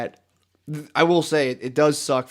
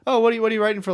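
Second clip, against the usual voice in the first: lightly voiced nosy mom, higher pitch; questioning mom; 8 semitones higher, 3 dB louder, much faster than usual. Son imitating mom asking about his writing, lightly questioning. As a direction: probe with a raised, mock-curious voice.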